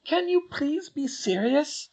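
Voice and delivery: put-on high girlish voice, proud of her work; a clever schoolgirl boasting; Hermione. A narrator's reading. Hermione explains her still to the twins, voiced high.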